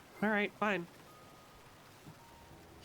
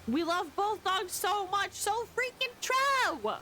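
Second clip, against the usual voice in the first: gushing; 9 semitones higher, slower than usual. Real, not acted. Gushing about the dogs.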